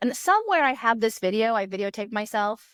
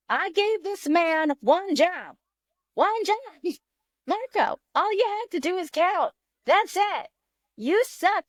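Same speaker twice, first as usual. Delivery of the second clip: high, exasperated mock rage at Marco; furious rant; 9 semitones higher, slower than usual. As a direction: rage at him for the one job he had. Furious at Marco for not counting.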